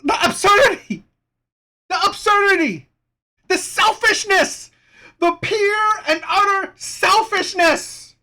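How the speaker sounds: high-pitched exclamations of real outrage; outraged. Real, not acted. Host reacting to a dater's selfishness, outraged. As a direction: cry out in indignation, drawing out the last words.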